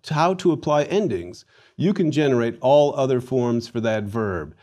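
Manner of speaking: ordinary delivery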